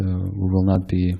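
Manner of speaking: ordinary delivery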